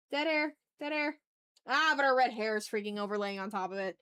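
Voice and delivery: ordinary delivery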